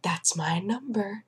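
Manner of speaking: hushed, slow mock amazement; awed near-whisper. Patient walks over as number called, mock awed whisper. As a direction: whisper it slowly as if chosen.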